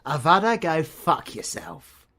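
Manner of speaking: loud, high, furious cut-off curse; spitting fury, then dragging the insult out slowly; angry outburst. Acted. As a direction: bite off the spell and swear at them. Character snapping at the viewer.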